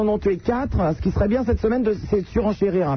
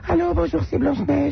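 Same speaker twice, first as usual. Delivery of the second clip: put-on much higher, sugary little-girl voice; 9 semitones higher than usual. Shown as a demonstration. Host showing caller her CB radio voice, high and girlish. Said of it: "sur la C B, je prends une petite voix".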